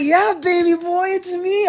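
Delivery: very high, loud holler back; friend's excited shout. Retold or imitated. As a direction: imitate a friend yelling from a car.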